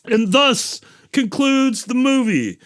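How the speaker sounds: high, slow, pompous sign-off; playfully theatrical closing line; mock-grand announcement. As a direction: announce the ending with mock ceremony. Mock-formal close of the retelling.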